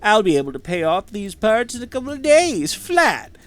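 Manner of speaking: smarmy voice drifting toward southern; oily schemer whose accent keeps wandering; smarmy shifting accent. Acted. Greedy tinkerer boasting to the player, smarmy shifting accent. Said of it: "His accent was different every, like, two sentences".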